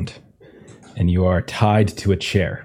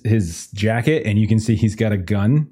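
normal talking voice

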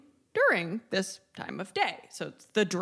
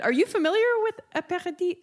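French accent slips in; 10 semitones higher, much faster than usual. Real, not acted. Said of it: "that's my French accent coming into Italian".

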